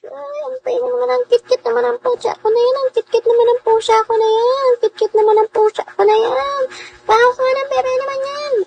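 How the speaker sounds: put-on sweet baby voice for her pet cat; baby talk to cat.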